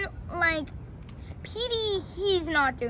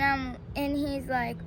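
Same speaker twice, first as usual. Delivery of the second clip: ordinary talk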